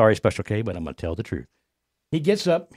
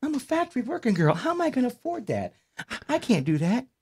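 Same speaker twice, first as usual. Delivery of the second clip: high mock female voice, dismayed; caricatured working girl balking at a price; imitated girlfriend; 12 semitones higher than usual. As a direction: protest in a pitched-up girlfriend voice. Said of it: "She says".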